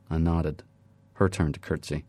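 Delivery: usual speaking voice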